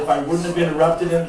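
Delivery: normal talking voice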